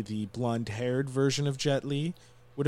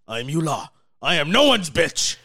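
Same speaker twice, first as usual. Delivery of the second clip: loud, higher evil emperor boast; cold rasping evil emperor; 5 semitones higher, 8 dB louder than usual. Retold or imitated. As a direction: bellow it like a tyrant. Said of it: "greatest line in all movie".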